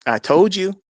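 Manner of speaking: emphatic vindicated declaration; triumphant. Retold or imitated. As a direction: crow it with pitch jumping up. Repeats it in triumph to wife.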